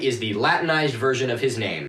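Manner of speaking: ordinary delivery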